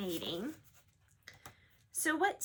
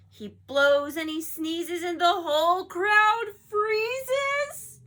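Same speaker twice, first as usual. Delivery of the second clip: dramatic loud narration; 5 semitones higher, 9 dB louder, slower than usual. A narrator's reading. Dramatic sneeze aftermath.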